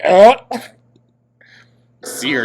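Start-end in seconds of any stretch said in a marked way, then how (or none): 0.0-0.3 startled grunt. Retold or imitated. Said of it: "She was like".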